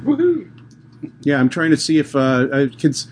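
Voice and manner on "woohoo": excited cheer. Real, not acted. Cheering the big audience.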